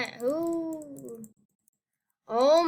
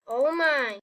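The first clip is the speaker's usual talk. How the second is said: normal talking voice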